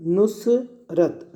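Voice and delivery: Nusrat slowly split as "nus... rat"; syllable by syllable. Shown as a demonstration. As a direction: break Nusrat into two separate parts, nus and rat. Teacher sounds out word in parts.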